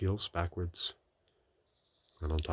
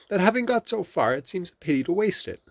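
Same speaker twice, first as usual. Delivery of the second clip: Rabbit; 13 semitones higher, 7 dB louder than usual. A narrator's reading. Rabbit voiced.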